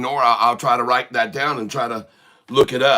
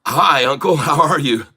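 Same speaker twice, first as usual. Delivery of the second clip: usual speaking voice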